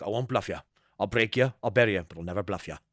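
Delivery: low, soft imitation of a menacing line; lightly voiced henchman, dropped down low; quoted movie villain. Fan quoting a henchman's threat, lightly imitated, low and soft. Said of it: "I just remember his lines".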